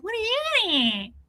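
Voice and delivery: put-on high childlike question; mock dumb self in a kid voice. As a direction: sound completely clueless. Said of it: "Dumb bum".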